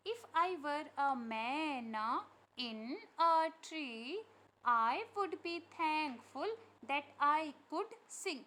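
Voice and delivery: very slow, loud, drawn-out verse reading; poem recitation. Acted. Teacher reading the myna verse to pupils, slow loud recitation. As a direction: stretch every word out for young listeners.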